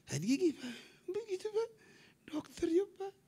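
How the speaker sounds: high-pitched mock feminine voice; wife's voice. Imitating his wife asking about the doctor, high voice. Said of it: "Istri saya langsung".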